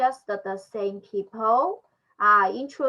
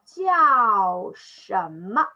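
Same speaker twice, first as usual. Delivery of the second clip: slow phrase reading; 4 dB louder, much slower than usual. Shown as a demonstration. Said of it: "just like".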